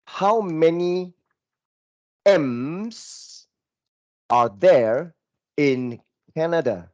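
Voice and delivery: slow, word by word, as if typing it; dictating each word to the keyboard; slow typing dictation. Shown as a demonstration. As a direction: spell out the question slowly while typing. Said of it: "look at the way I type".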